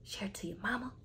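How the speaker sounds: hushed whisper, breath only. Acted. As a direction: whisper it so nobody upstairs hears. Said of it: "I can't stop 'cause I'm upstairs".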